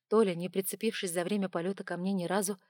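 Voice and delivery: plain reading voice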